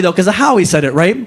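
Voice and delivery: usual speaking voice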